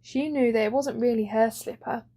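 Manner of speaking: ordinary delivery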